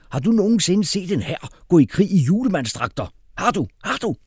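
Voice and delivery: high, loud furious tirade; enraged shouting. A narrator's reading. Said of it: "råbte hr. Vibrant, som var blevet ildrød".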